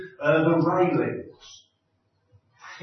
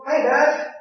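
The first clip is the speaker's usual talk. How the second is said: high, louder, joyful call; child's yell; 10 semitones higher, 6 dB louder than usual. Retold or imitated. Son yelling to his dad mid-jump, high joyful shout. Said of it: "and then yelled".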